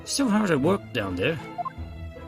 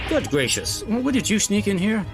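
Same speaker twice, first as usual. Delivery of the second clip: high, loud startled outburst; 10 semitones higher, 5 dB louder than usual. Acted. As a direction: jump at the sudden appearance. Startled by Sholmes appearing.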